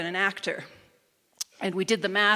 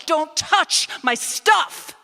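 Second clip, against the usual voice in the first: slow, much higher, much louder shout; furious shout; 9 semitones higher, 11 dB louder, much slower than usual. Retold or imitated. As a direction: shout it out in full fury. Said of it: "in my fury".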